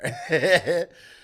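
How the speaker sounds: bursting out laughing; loud laugh. Real, not acted. Comic reacting to the Griner news, loud laugh. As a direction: break up laughing.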